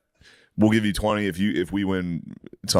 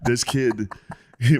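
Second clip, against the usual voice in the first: ordinary talk, nothing marked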